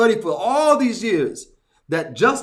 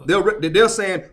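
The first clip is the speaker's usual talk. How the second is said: ordinary talk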